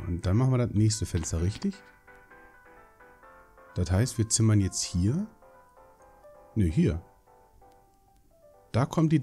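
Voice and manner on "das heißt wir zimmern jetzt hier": ordinary talk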